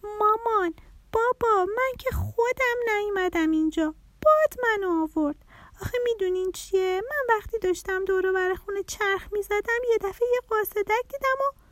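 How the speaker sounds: put-on high-pitched little girl voice; small butterfly child's high voice. A narrator's reading. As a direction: plead like a small child explaining to parents. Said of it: "پرناز کوچولو گفت".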